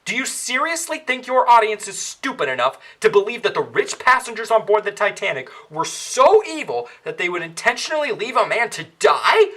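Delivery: normal talking voice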